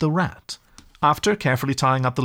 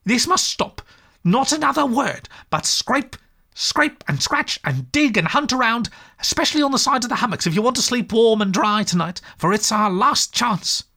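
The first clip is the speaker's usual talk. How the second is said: much higher, sharp angry scold; really angry; 12 semitones higher than usual. A narrator's reading. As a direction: tell off a stubborn friend. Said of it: "really angry".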